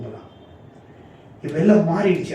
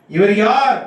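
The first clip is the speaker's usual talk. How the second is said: raised, ringing rhetorical question; loud proclamation; 6 semitones higher, 6 dB louder, slower than usual. Real, not acted. Preacher challenges the congregation with who He is, loudly proclaimed.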